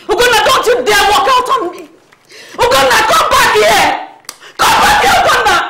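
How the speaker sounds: a mother calling after her son as he leaves; shouting after him. Acted. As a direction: stop him from walking out.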